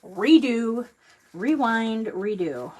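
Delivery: loud, annoyed self-correction; exasperated outburst. Real, not acted. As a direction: scold yourself out loud. Frustrated at a messed-up ribbon.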